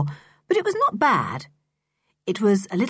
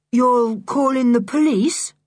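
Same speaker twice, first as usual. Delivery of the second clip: spottend uitdagend; 5 semitones higher, 5 dB louder than usual. A narrator's reading. Julian mockingly finishes her threat.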